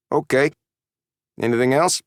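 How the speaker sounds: high, bored brush-off; disinterested. A narrator's reading. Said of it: "his disinterest evident".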